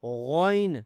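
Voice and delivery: غین said thick and heavy from the throat; heavy ghain. Shown as a demonstration. Teacher demonstrating a heavy غین to students. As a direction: give غین a full heavy velar sound. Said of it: "اس کو بھی موٹا کرنا ہے".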